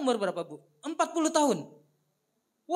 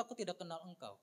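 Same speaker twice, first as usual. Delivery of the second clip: much softer, final verdict; cold rejection; 2 semitones lower, 14 dB quieter, faster than usual. A narrator's reading. Bridegroom refusing the virgins, very soft. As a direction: close the door quietly.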